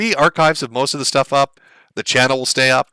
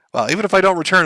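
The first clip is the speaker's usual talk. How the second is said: normal talking voice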